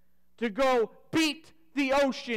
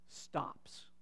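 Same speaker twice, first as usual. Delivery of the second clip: whispered; 15 dB quieter than usual. Acted. Storm stops, dramatic hush.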